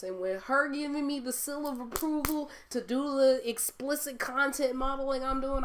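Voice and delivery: ordinary talk, nothing marked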